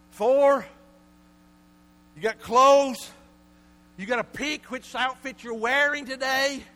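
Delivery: loud, high, rising preacher's call; preaching at full force. Real, not acted. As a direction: hammer each question at the congregation. Preacher building to a shout.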